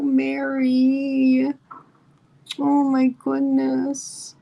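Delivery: high, slow and choked up with emotion; moved to tears. Real, not acted. A streamer tearing up at a viewer's loving marriage story, high and slow. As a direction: let the feeling catch your voice as you slowly say her name. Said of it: "you have me in tears".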